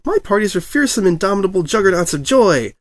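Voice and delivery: high, proud declaration; grand Pinkie boast. A narrator's reading. Pinkie boasts about her parties, high and grand. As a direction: proclaim it like a battle cry.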